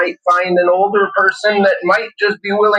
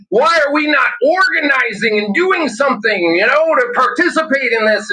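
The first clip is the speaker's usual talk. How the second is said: higher, whiny parody of the complainers; mocking whine; 7 semitones higher than usual. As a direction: mimic them as pleading and naive. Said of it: "they're like".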